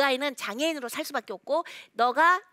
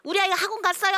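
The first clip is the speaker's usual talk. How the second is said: higher, louder mock report of relief; relieved mother; 8 semitones higher, 5 dB louder, faster than usual. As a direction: chirp it like a reassured mom. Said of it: "하고 안심이야".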